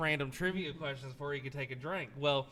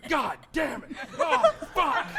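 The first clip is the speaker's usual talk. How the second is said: mock agonized cursing, higher and louder, breaking into laughter; imitated pained cursing; 10 semitones higher, 7 dB louder, slower than usual. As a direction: play a man in pain while you crack up. Imitating friend cursing while vomiting.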